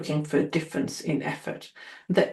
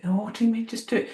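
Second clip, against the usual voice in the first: higher-pitched baffled comeback; mock bewilderment; imitated puzzled trainee; 6 semitones higher, faster than usual. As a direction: query it as a puzzled learner.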